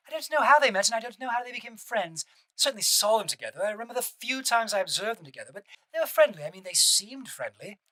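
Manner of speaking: put-on a mild British accent for Ghislaine Maxwell's testimony. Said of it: "my British accent".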